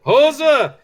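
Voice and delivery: high, laughing cry of hoser; laughing Canadian hoser. Real, not acted. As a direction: bark out hoser through a laugh. Repeating chat's hoser.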